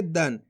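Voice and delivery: दन ending in a half न, pitch dropping; half-n nasal. Shown as a demonstration. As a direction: say दन as one syllable closed on n. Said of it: "इस तरीके से इसका उच्चारण होता है".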